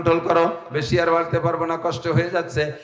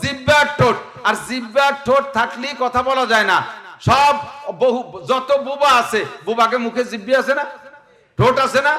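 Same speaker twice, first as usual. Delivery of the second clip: full-force preaching; 7 semitones higher than usual. Acted. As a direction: preach it out to the crowd, voice raised high. (উচ্চ স্বরে জোরালো ওয়াজ)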